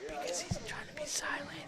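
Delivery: whispering. Real, not acted. Answering why he whispers.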